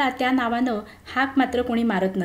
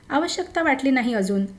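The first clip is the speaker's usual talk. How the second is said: plain reading voice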